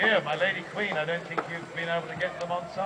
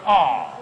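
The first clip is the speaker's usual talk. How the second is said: drawn-out pitying aah; sympathetic aww; 8 semitones lower, 7 dB louder than usual. Shown as a demonstration. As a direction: model the crowd's sympathy sigh. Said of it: "They go".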